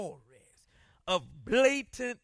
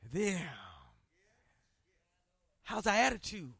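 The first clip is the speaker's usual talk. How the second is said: ordinary delivery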